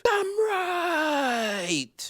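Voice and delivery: high, loud cry of agreement; loud exclaim. Acted. Agreeing loudly with the Italian girls' frugal cooking, loud high cry. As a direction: belt out the agreement. Emphatic agreement after quote.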